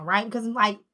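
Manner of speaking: ordinary delivery, nothing marked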